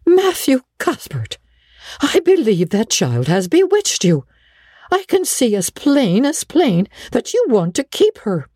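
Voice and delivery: high-pitched, indignant amazement; astonished. Acted. Marilla accusing Matthew of being bewitched, astonished. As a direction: exclaim it high, aghast at him.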